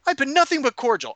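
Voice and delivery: indignant, high-pitched protest; aggrieved Bertram. Retold or imitated. Bar owner reproaching his guests, high and aggrieved. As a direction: protest like a wronged host. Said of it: "And he's like".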